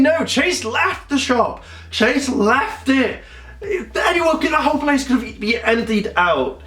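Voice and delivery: high-pitched, stretched-out exasperation; indignant disbelief. Real, not acted. Reviewer objecting that Chase left the shop, indignant. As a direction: protest in high, drawn-out disbelief. Protesting the shop was abandoned.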